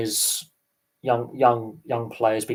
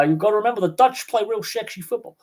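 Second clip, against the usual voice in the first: put-on Dutch accent; 9 semitones higher than usual. Podcaster returning to his Dutch joke, high Dutch accent. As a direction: snap back into the Dutch bit. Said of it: "my Dutch accent".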